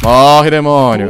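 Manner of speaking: loud and slow, each word dragged; slow drawn-out battle shout. Real, not acted. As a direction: stretch out the war cry. Shooting at enemies.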